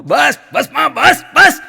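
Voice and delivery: high loud trance shout; hammed-up possessed woman shouting; mock shout. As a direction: scream it out in a trance. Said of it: "બેન રાણું પાડ્યો".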